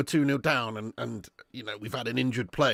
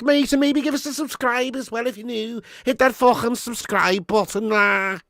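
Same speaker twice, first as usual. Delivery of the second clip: higher, louder put-on Scouse accent, slowed on some lines; a hammed-up Scouser asking for likes in a Scouse accent; 8 semitones higher, 7 dB louder than usual. Streamer to new Scouse viewers, hammed-up Scouse accent. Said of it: "Let me talk to you in Scouse".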